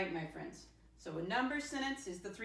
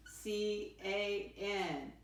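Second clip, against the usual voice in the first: slow letter by letter spelling; spelled out slowly; much slower than usual. Shown as a demonstration. Teacher spelling a word for her class, slowly. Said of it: "How do I spell can?".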